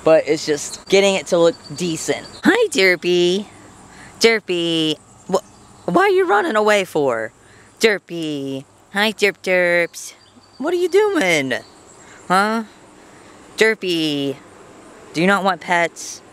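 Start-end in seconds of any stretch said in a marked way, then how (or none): 2.4-14.4 pet talk. Real, not acted. Owner coaxing Derpy back in baby talk. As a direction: coax the running pet sweetly.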